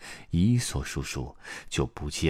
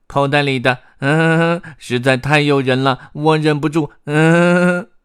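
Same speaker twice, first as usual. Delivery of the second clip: high, loud greedy eater; squeaky voice with munching hums; mouth stuffed full; 11 semitones higher, 12 dB louder than usual. A narrator's reading. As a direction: gush through a mouthful of cheese. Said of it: "贝利奥嘴里塞得满满的".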